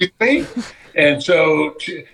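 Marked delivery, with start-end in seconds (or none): 0.2-0.6 indignant. Retold or imitated. Wife's indignant retort.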